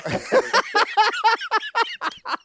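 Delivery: loud, high-pitched burst of laughter; big laugh. Real, not acted. Racer teased about his car, loud high laughing fit. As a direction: crack up loudly at being teased.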